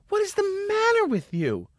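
very high screechy imitation of a scolding lady; mock hysterical indignation in falsetto; shrill outraged woman. Same scolding, escalated.

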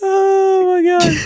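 high, teary laughing exclamation; helpless real giggles; weak from laughing. Real, not acted. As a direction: gasp it out, crying with laughter. Worn out from laughing.

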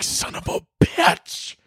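high, slow, breathy hush of dismay; under the breath. Retold or imitated. Aunt walks in at Christmas, whispered curse. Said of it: "you're like".

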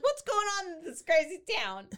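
high, laughing exclamation of bewilderment; mock confusion. Storyteller voicing everybody in the crazy town, mock confused. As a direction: cry it out in hammed-up disbelief. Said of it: "so confused".

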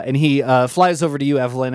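ordinary talk

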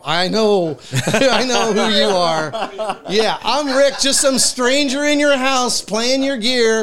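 starstruck excitement. Retold or imitated. Guest meets his guitar hero in the studio, nervous laughing excitement. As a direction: gush like a starstruck fan caught red-handed. Meeting [name], starstruck.

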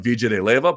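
Villa de Leyva with the double L as J; English J in Villa. Shown as a demonstration. Another Colombian city as proof, J-sound Villa. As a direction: say Villa as "VEE-juh". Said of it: "they also pronounce the same goddamn way".

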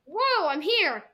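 slow, loud, high "whoa" of surprise; drawn-out, playful astonishment; mock amazement. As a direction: act suddenly astonished to find yourself there. Acts out suddenly becoming the character.

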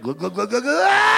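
imitated glugs rising into a scream; gulp, then climb to a high shriek; refuelled kid, glugs into a yell; gulping juice. Kids gulping juice, recharging.

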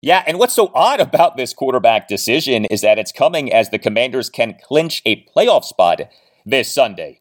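ordinary talk, nothing marked

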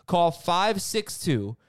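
upbeat sponsor read voice. Acted. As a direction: sell it like a radio ad. Host reads sponsor ad.

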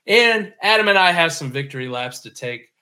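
ordinary delivery

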